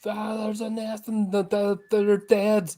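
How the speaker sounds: high, stammering panic; high-pitched, halting dread; panicked survivor. Acted. Survivor panicking about the nest.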